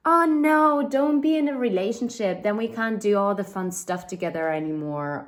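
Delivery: high, loud mock whine; whiny clingy friend. Mocking a clingy friend, a high loud whine. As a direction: whine like a friend afraid of losing you. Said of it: "a friend who would say".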